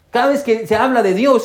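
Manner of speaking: ordinary talk, nothing marked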